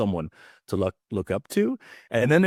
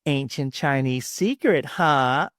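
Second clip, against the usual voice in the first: higher, slow mock-skeptical lady; commercial customer; 6 semitones higher, slower than usual. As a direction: play a customer catching out the shopkeeper. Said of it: "the lady ... goes".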